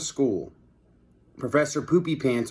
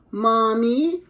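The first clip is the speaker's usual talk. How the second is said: put-on high little boy voice; 11 semitones higher than usual. A narrator's reading. Little boy calling to his mother. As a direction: squeak it like an excited small kid. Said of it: "said a little boy".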